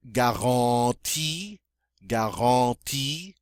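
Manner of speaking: "garantie" slowly, with a nasal "an"; French nasal sound. Shown as a demonstration. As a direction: stretch the nasal middle vowel of "garantie". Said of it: "make nice nasal sounds".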